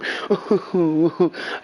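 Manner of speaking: short mocking laugh. Real, not acted. Laughs at mock victim.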